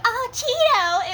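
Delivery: high, slow, dreamy sigh of his name; a lovesick moan, not a scream; mock swooning sigh. Shown as a demonstration. Mocking a dreamy moan to contrast terror screams. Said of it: "like, terror screams".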